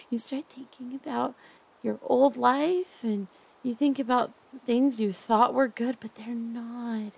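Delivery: hushed, doting captor who won't let go. Acted. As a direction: lull your captive with creepy tenderness. Holds a soft captor roleplay voice.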